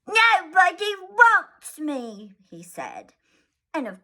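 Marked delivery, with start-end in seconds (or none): 0.1-2.3 put-on high, slow sulky little boy; a child's wounded sulk. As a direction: pout it out like a hurt child. Michael sulking, narrated.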